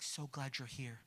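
very soft, low imitated welcome; gentle greeting. Imitating smiling man greeting the circle, softly and gently. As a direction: murmur it like a kindly retreat leader.

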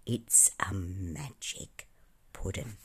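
slow, hushed secret; low confidential voice. A narrator's reading. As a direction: lean in and share it very softly. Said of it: "said in a low voice".

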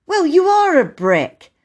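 put-on high, loud boyish gratitude; enthusiastic. A narrator's reading. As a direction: praise him with delight. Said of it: "said Peter with enthusiasm".

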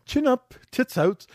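very high mock Hollywood diva; Toni Storm. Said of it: "classic Hollywood diva starlet".

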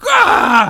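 piercing high yell; loud scream. Retold or imitated. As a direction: scream at the kids. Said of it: "he's like; completely loud".

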